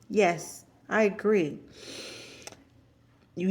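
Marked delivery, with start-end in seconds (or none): none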